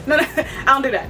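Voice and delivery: no, no through laughter; laughing refusal. Real, not acted. Cook laughing off the idea of tartar sauce, laughing no.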